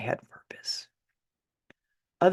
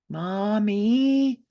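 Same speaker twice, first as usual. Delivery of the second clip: put-on small child; 6 semitones higher, 4 dB louder than usual. Small child calling at door.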